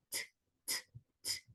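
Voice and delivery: "t, t, t" as separate clicks of breath; voiceless T. Shown as a demonstration. Teacher showing T is not voiced, isolated breath-only t. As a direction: tap out "t, t, t" without any voice. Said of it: "no es sonoro".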